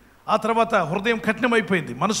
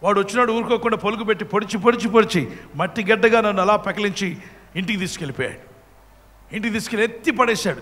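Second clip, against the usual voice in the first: ordinary talk, nothing marked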